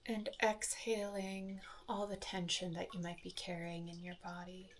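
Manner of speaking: soft, unhurried meditation voice with long pauses; soft calm phrases, spaced out by silence; guided meditation. Acted. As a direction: guide listeners gently through each breath. Leads a guided breathing meditation.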